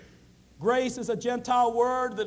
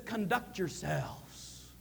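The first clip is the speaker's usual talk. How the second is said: softer, drawn out slowly and low; slow soft quote; 5 semitones lower, 7 dB quieter, much slower than usual. Shown as a demonstration. Paul urging citizens to behave, said slow and soft. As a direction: lay it down quietly, unhurried. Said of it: "when he says".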